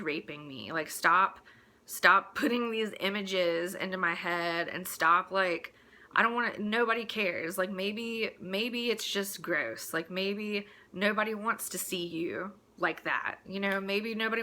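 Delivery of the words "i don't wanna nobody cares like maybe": ordinary delivery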